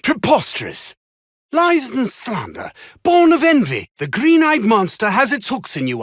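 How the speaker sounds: outraged, affronted protest; indignant outburst. Acted. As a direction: bristle with offended pride. Indignant denial of accusation.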